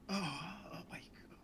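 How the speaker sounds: breathless whisper; awed whisper. Acted. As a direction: whisper in awe. Overwhelmed in hug.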